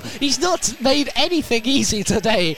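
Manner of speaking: high, loud rising call of the shot; bursting with excitement as the keeper saves; excited commentary. Real, not acted. Commentator calling a near goal to listeners, excited and loud.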